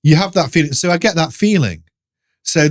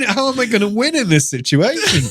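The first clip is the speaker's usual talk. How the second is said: higher pitch, broken by laughter; laughing exasperation; 8 semitones higher than usual. Retold or imitated. Man retelling being stuck with monster and snorer, laughing. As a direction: ask it helplessly through laughs. Laughing at his own predicament.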